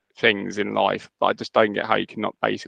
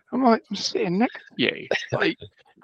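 higher, incredulous "I'm sitting next to you"; exasperated; 12 semitones higher than usual. Retold or imitated. Venting about a coworker's email, higher and exasperated. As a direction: throw up your hands at the coworker.

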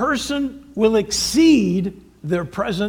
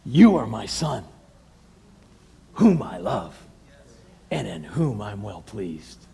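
tender divine voice; 10 semitones lower than usual. Retold or imitated. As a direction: speak it like a tender blessing. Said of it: "What did Jesus hear when he was baptized?".

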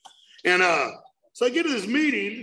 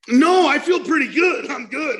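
cocky brush-off; 7 semitones higher, 7 dB louder than usual. Retold or imitated. Refusing to go to a meeting.

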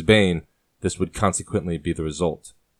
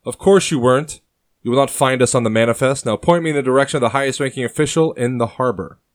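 higher, louder curt command; fierce demand; 6 semitones higher, 4 dB louder than usual. Acted. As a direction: cut him off and demand it. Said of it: "the fierce and notorious officer demands".

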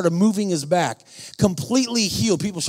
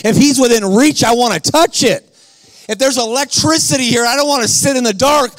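high, forceful pulpit delivery; full-force preaching; 5 semitones higher, 3 dB louder than usual. Real, not acted. Preacher urging men to take back manhood, fervent high preaching. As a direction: drive each line home to rouse the room.

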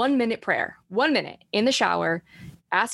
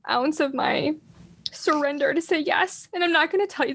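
near tears; 8 semitones higher than usual. Real, not acted. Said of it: "I'm gonna cry".

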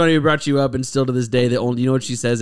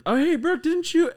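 bright high mock call. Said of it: "I was like".

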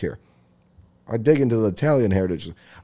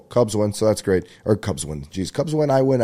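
ordinary delivery

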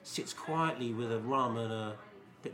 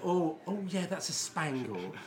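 high, drawn-out mock relish; put-on delighted recognition; savouring connoisseur; 6 semitones higher, slower than usual. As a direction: savour it slowly like a taster in a joke. Joke character savouring and identifying sweet.

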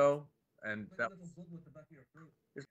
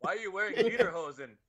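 put-on higher playful Swedish accent; 7 semitones higher than usual. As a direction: act out the Swede from the movie. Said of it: "a Swedish accent".

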